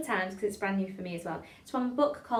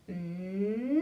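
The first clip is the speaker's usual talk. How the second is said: closed-mouth hum sliding up and down; hummed siren. Shown as a demonstration. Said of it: "it sounds a bit like this".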